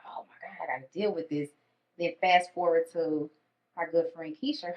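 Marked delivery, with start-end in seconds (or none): 0.1-1.5 breathy whisper of sudden grief; a stunned whisper to herself; whispered shock. Retold or imitated. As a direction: hush it like it just hit you. Retold hushed shock.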